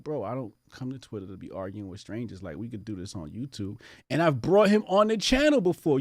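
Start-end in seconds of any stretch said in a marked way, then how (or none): 0.0-3.8 much softer, lower, offhand; calm brush-off under the breath; softly retelling his reply to the Twitter troll; quoting himself softly. Retold or imitated. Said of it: "I'm just like".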